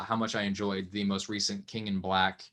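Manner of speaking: ordinary talk, nothing marked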